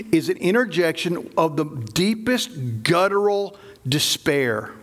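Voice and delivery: normal talking voice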